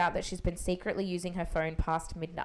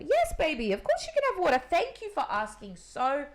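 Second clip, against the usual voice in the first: sweet praising mum; 5 semitones higher than usual. Retold or imitated. Mother praising child sweetly.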